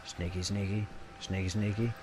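hushed, mock-stealthy chant; playful sneaking. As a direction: tiptoe through it very softly. Playing stealthy rogue before a stab.

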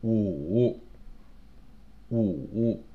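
"五" dropping low and coming back up; dipping third tone. Shown as a demonstration. Teacher marking tones on numbers, low and dipping. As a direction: draw "五" through a low dip. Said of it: "third tone".

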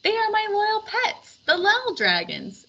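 high booming king voice; a big-voiced king calling out; jolly king. A narrator's reading. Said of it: "explained the king".

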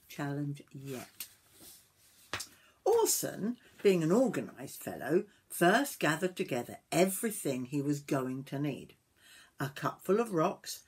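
lively, sing-song storybook reading; story narration to children. A narrator's reading. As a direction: read it aloud to young children.

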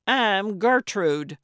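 loud, slow, pointed; pointed introduction. A narrator's reading. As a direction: cut in possessively. Jealous Gertrude interrupting.

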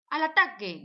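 loud playful charge; battle cry. Acted. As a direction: yell it like a kid launching an attack. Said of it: "gritó el ratoncito".

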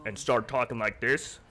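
showing chat his deep voice. Shown as a demonstration. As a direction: drop into a deep voice. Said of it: "sometimes I can go deep".

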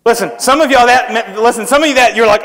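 normal talking voice

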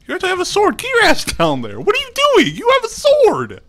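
very high, loud, squeaky yelling; mock scolding. Gamer scolding fighter with a sword, high mock yelling. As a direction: yell at them in a high squeal.

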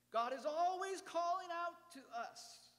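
high, loud proclamation; preacher's rising declaration; emphatic proclaim. Acted. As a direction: ring it out. Sermon climax, emphatic.

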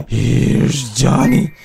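hammed-up menacing call, lightly voiced; mock Shining taunt. Quoting The Shining while hunting.

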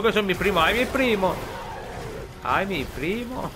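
hammed-up alarm at the cousins; mock panic. Streamer fleeing enemies, mock panic.

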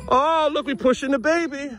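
high, excited parent cheering; delighted play voice. Real, not acted. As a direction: coo with high-pitched delight at the game.

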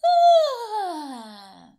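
frustrated grunt; a grumpy Ah for the child to copy; high grumpy Ah; sound. Pretend. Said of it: "Can you go".

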